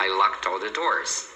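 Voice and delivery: LOCKED ALL joined as "lock-tall"; slow, connected "locked all the doors"; linked words. Shown as a demonstration. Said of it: "tem que ir acompanhando assim".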